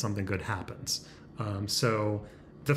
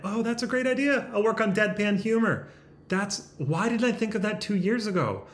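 excited; 8 semitones higher than usual. Real, not acted.

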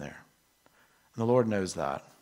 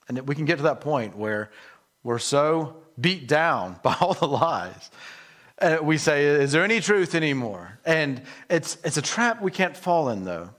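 louder and higher, pushing the point home; fervent preaching; 4 semitones higher, 6 dB louder than usual. Acted. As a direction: rise into full pulpit fervor. Preaching builds to full force.

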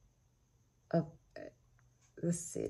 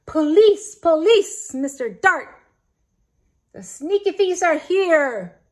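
high, loud alarm yell; shouting for the police at full volume; loudest shout; 7 semitones higher, 11 dB louder than usual. Acted. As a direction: bellow it to raise the alarm. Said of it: "he shouted in his loudest and most terrifying voice".

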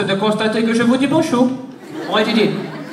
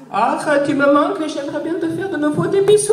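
much higher voice, pining lovingly; Angela's voice; 8 semitones higher than usual. Pretend. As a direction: sigh it like a lovesick Angela.

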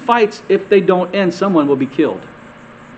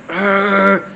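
drawn-out "argh" of exasperation; frustrated groan; 3 semitones higher than usual. Acted. Preacher acting out feeling overwhelmed, frustrated groan. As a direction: let out a pent-up, frustrated growl. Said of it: "when you're feeling like".

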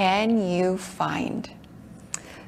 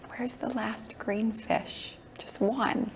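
hushed searching whisper; 2 semitones higher, 12 dB quieter, slower than usual. Acted. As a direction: whisper it like a secret.

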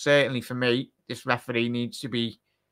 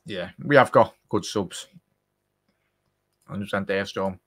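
normal talking voice

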